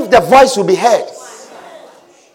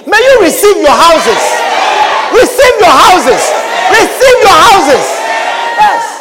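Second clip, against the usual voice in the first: loud blessing, climbing higher with each repeat; fervent, raised-voice declaration; full-force preaching; 6 semitones higher, 4 dB louder than usual. Real, not acted. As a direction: proclaim it over the congregation, building each time. Preacher pronouncing houses on congregation.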